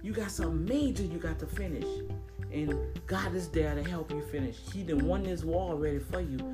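usual speaking voice

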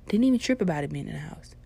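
ordinary delivery

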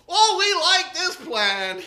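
loud, slow, eager agreement; loud, drawn-out mock enthusiasm; eager crowd. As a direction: cheer the plan on like a keen crowd.

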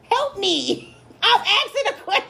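high-pitched, giggly plea for help; laughing plea. Real, not acted. Confused host begging friends for help, laughing plea.

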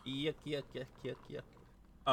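slow, deliberate fake laugh; mock laugh. Co-host winding down the joke, slow mock laughter. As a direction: space out each goofy fake "yuck".

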